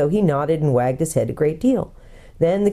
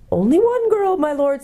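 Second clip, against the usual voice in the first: very high hooting correction; owl calling out; 11 semitones higher than usual. A narrator's reading. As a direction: hoot it up high for deaf ears. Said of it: "as loud as it could".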